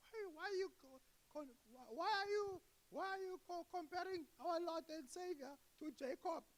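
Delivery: high-pitched stammering outrage; mock angry believer. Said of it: "People are gonna get angry".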